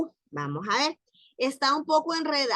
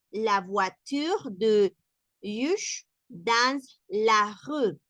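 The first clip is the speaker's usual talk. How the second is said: very slow, word by word French; slow French reading; much slower than usual. Shown as a demonstration. Teacher slowing the example down for learners. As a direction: spell out each word in slow motion. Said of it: "tu as vu".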